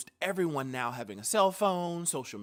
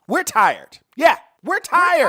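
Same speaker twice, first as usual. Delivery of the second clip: loud outburst, climbing much higher; exasperated shout; 10 semitones higher, 14 dB louder than usual. Acted. Speaker pleads for strong friends, exasperated shouting. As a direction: yell it out for all the strong friends.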